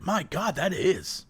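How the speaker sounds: hushed awe at a perfect voice; awed hush. Retold or imitated. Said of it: "and I was like".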